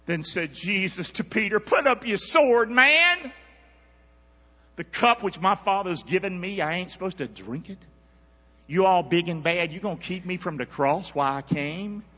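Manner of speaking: rising preacher voice giving Jesus's words; preaching. Acted. Preacher to church, voicing Jesus rebuking Peter. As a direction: preach it with heat.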